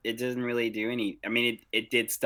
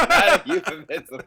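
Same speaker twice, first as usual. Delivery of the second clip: laughing through the words; 10 semitones higher, 4 dB louder than usual. Real, not acted. Echoing the euphemism joke, laughing. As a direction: repeat it while cracking up.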